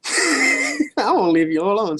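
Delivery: ordinary delivery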